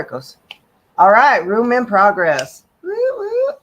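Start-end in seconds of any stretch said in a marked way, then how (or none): 1.0-2.7 excited announcement. Real, not acted. Room finally goes live. 2.8-3.5 high, bright little woo woo; excited cheer. Real, not acted. Host cheering as the Clubhouse room finally opens, excited whoop.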